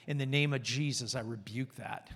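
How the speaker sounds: low, hushed private rebuke; quiet prayer under the voice; inward prayer. Shown as a demonstration. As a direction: say it softly, as if only in your heart. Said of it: "in your heart to say".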